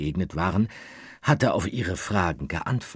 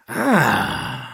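surprised ah; 7 semitones higher, 6 dB louder than usual. Acted. Mortimer stops, discovers something.

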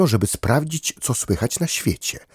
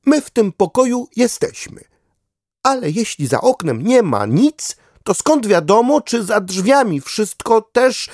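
very high, questioning duck voice; a duck puzzling anxiously; worried duck; 10 semitones higher, 3 dB louder than usual. Acted. Duck to dog, anxious question in a very high voice.

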